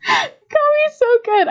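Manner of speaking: slow, very high laughing squeal; real uncontrollable laughing. Real, not acted. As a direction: laugh so hard the words stretch out. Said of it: "I can't stop laughing at myself".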